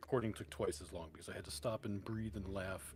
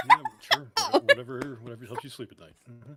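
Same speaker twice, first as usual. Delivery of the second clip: sarcastic; 2 semitones higher, 14 dB louder than usual. Real, not acted. Teasing disbelief at partner.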